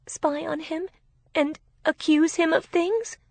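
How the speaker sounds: high-pitched tearful answer; crying. A narrator's reading. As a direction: answer meekly, close to tears. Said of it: "She was crying".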